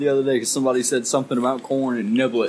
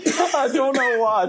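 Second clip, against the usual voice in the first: laughing; 10 semitones higher, slower than usual. Real, not acted. Laughing at the memory.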